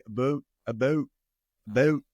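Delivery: "about" with the Canadian OU, toward "a-boat". Shown as a demonstration. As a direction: round the vowel in "about" like a Canadian. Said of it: "when they say".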